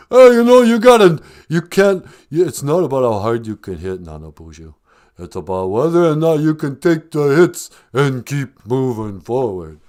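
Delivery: boxer pep talk; Sylvester Stallone. Acted. Film star advising the host, rousing boxer impression. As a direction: burst in loud and high, then steady down. Stallone repeats his motto.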